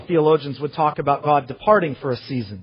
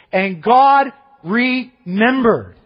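slow, drawn-out, louder and higher proclamation; slow emphatic; 6 semitones higher, 6 dB louder, much slower than usual. Acted. Preacher dwelling on scripture, slow solemn proclamation. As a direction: stretch each word out with weight.

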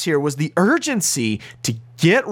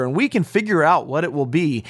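ordinary delivery

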